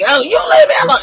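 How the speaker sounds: high, loud crying shout; screaming at her on stream; retold yell. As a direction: yell it out with a sob. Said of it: "I'm yelling for the chat".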